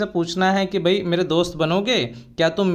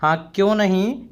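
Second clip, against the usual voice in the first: ordinary talk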